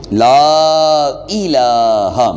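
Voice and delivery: لا الہ with the لام of لا drawn out long; slow Kalima recitation. Shown as a demonstration. Teacher recites لا الہ slowly to students. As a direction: stretch لا الہ slowly, lengthening each لام. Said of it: "لام کو تھوڑا سا لمبا کریں گے".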